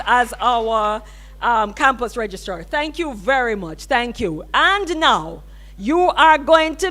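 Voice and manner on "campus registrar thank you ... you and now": usual speaking voice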